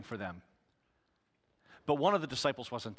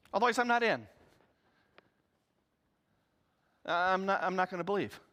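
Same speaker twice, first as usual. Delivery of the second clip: defiant Thomas; 8 semitones higher, faster than usual. Acted. Thomas challenging the disciples, high then dropping. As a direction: dig in, then wind down.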